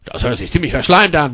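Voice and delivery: ordinary delivery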